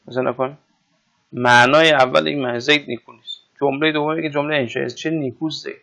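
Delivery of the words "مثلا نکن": ordinary talk, nothing marked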